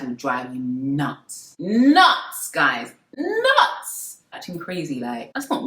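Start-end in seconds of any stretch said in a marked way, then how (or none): 1.6-2.5 "Nuts" high, loud and rising; emphatic exclamation. Real, not acted. Repeats the word as an exclamation. 3.2-4.1 "Nuts" squeaked very high, rising; exclaimed repeat. Real, not acted. Repeats the word higher for effect.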